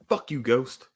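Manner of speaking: usual speaking voice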